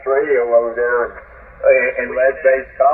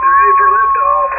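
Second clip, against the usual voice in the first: mock launch call; 4 dB louder than usual.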